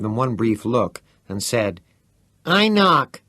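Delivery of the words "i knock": put-on a high, loud boy's voice announcing the knock; Teddy's voice. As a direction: announce it with cocky glee. Teddy plays his hand.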